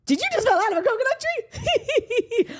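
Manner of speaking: very high, slow, laughing mock-quote; hammed-up politician breaking into a giggle; Kamala Harris. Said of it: "and her laugh is, it's infectious. Like, she's always laughing".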